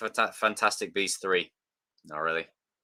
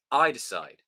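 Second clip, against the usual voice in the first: ordinary talk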